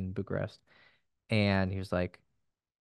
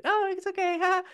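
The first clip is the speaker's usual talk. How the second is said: much higher, mock giddy girl voice; giddy nervous girl. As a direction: imitate a nervous flustered girl, pitched way up. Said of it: "giddy and nervous".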